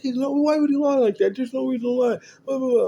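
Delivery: high, loud mock-whiny wife. Wife defending son mockingly.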